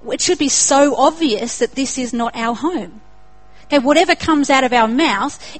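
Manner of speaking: usual speaking voice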